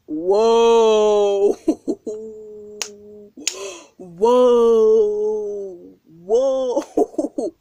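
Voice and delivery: booming, drawn-out whoas; loud whoa. Real, not acted. To the listeners at the story's peak, loud drawn-out disbelieving whoas. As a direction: blast out each whoa in disbelief. Laughing at her own story.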